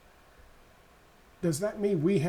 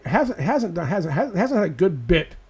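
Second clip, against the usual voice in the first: usual speaking voice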